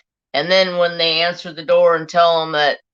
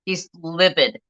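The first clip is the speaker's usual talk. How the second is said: ordinary talk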